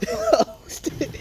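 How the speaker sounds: very high oh through a laugh. Real, not acted. Cracking up at the cousins prank, laughing oh. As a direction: let the oh break into laughter.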